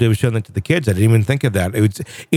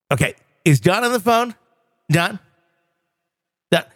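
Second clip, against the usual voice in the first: higher, exasperated radio-host tirade; Casey Kasem rant; 5 semitones higher than usual. Retold or imitated. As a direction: demand the pictures like an irate DJ. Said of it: "the Casey Kasem outtake reel".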